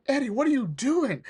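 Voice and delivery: high, loud mock alarm; alarmed challenge. Other guy to Eddie as he points the gun, mock alarmed.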